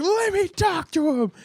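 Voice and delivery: high-pitched mock-angry demand; imitated demanding mom. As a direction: insist like a mom on the warpath. Retold mom demanding the kid.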